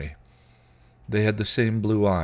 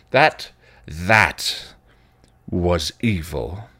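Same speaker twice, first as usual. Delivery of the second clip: dark rant; 6 dB louder, much slower than usual. Acted. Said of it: "his rant".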